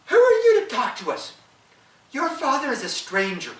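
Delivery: put-on high, loud jeering children; mock the boy like a gang of kids; taunting children. Children taunting the fatherless boy, high jeering voices.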